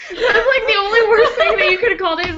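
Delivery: high laughing words, fast. Real, not acted. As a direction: laugh while talking. Laughing over short round.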